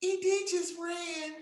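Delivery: put-on high, slow little girl's voice; a shy child reporting trouble, slowly; timid girl telling teacher; quiet student. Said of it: "She said".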